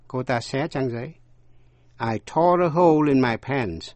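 ordinary talk, nothing marked

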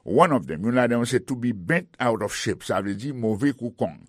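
ordinary delivery

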